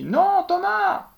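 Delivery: very high, loud, drawn-out scream; desperate scream. A narrator's reading. Policewoman screams at Thomas as the gun fires. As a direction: scream his name to stop the shot. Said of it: "La femme crie".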